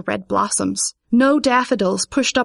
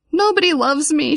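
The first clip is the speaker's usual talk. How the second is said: raised, wailing complaint; upset cry; 6 semitones higher than usual. A narrator's reading. Vivian alone in the woods, upset high cry. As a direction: wail it like a hurt child. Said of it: "she cried".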